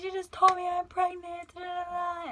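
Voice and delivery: high, distressed blurting; retold panic. Retelling panicked news to mom.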